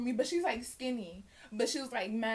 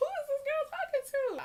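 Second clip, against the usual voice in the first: high, squeaky indignant voice; mock outrage. Girl answering an insult with high squeaky outrage. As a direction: act stunned and offended. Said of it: "I was like".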